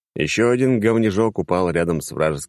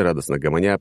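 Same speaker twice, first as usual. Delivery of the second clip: ordinary delivery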